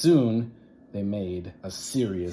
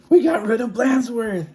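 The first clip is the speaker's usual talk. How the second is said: high, loud triumphant cheer; gleeful kids; 14 semitones higher, 10 dB louder than usual. Acted. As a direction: crow over getting rid of him. Kids celebrate teacher gone.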